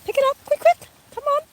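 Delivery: very high "pick it up, quick"; squeaky dog voice. Real, not acted.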